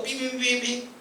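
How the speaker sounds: high sustained beeping noise; rewind beeps. Pretend. Preacher pretending to turn back time, playful beeping. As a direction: imitate a tape rewinding with beeps.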